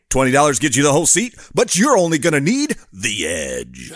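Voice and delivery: radio ad read. Shown as a demonstration. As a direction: punch it like a local speedway ad. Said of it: "I'm gonna do it right now".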